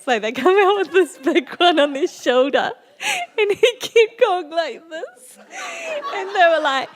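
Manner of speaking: high, loud, teary laughter through the words; laughing so hard it nearly becomes crying; laughing to tears. Real, not acted. Helpless laughter telling the story.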